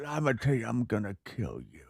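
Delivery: put-on low, soft toothless old mobster; mumbling old Italian American mob accent. Shown as a demonstration. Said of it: "are you an old man on a bench with no teeth".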